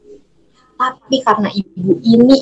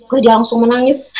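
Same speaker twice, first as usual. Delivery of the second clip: usual speaking voice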